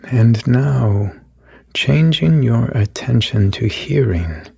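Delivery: calm, even meditation voice with long pauses; guided meditation. Acted. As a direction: lead the listener gently through each breath. Leads a guided body meditation.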